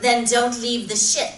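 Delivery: sharp loud retort; loud snap. Acted. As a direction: cut him off hard. Captain snaps back loudly.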